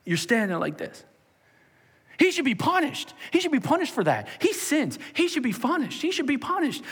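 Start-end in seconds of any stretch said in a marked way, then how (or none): 2.2-6.8 high, loud accusing cry; accusing shout. Acted. Sanhedrin accuser demanding the sinner be punished, shouting high.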